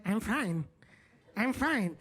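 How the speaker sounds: tight, clipped denial of being upset; passive-aggressive "I'm fine". Retold or imitated. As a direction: say it like you're clearly not fine. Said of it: "in that tone".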